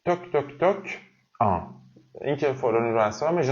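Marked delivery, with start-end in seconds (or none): 0.1-0.8 high, sharp toc toc toc like knuckles on wood; imitated knocking. Shown as a demonstration. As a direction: knock on the door with your voice. (تقلید صدای در زدن با صدای زیر)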